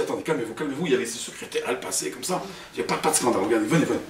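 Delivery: acted discreet soothing; hushed placating. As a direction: hush someone to avoid a scandal, voice dropped. Director hushing him, avoiding scandal.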